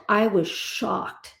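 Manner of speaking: slow, weighty stress on "I was shocked"; emphatic shock. Real, not acted. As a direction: drag "I was shocked" out slowly for effect. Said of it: "when I tell you I was shocked".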